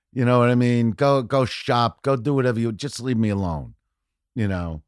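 ordinary talk, nothing marked

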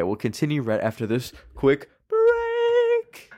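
slow, announcer-style break tease; a touch of TV host; announcer sign-off. Acted.

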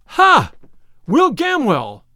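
high, loud cry of recognition. A narrator's reading. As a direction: exclaim at finding lost kin. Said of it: "cried Robin".

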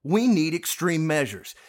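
ordinary delivery

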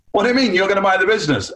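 high, fast incredulous question; scoffing disbelief. Retold or imitated. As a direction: mimic them scoffing at a ridiculous offer. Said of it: "they started laughing".

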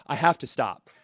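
ordinary talk

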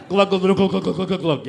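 playful glug-glug gulping; glugging noise. Retold or imitated. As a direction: imitate chugging a drink. Miming guzzling booze.

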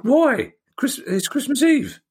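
retelling his outraged shock at working Christmas Eve; shocked disbelief. Retold or imitated. Host recalls hearing of the Christmas Eve pod, high shocked disbelief. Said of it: "and I went".

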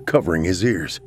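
ordinary delivery, nothing marked

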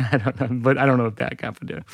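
ordinary delivery

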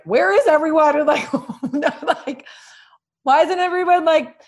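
mock outrage. Recalling wanting special treatment while pregnant, mock indignant. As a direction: play up the diva demand, high.

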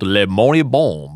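put-on slow, low French accent. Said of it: "That's a very good French accent".

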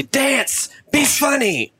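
high-pitched needy heckler; high, mock crowd demand; mock demanding yell. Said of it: "they yell at you to do things".

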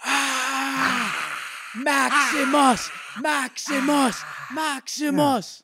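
slow, high drawn-out chant of Maximus; roman orator. Pretend. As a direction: call the name like a gladiator crowd. Crowd chant after orator announcement.

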